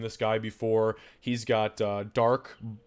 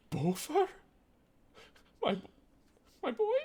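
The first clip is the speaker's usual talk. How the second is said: high, fragile voice of a long-lost mother; mother's voice. Acted. GM voicing the mother meeting her son again, high and fragile. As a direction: greet the son in disbelief.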